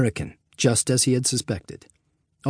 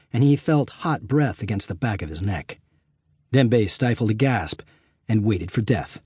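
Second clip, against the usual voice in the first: ordinary delivery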